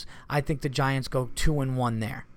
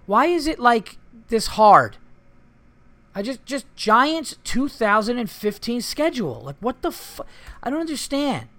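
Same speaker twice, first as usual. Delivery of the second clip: high, loud, exasperated whine, stretching out "this hard"; pleading with the screen in disbelief; 10 semitones higher, 7 dB louder than usual. Real, not acted. Host failing to find the Giants schedule online, exasperated high whine.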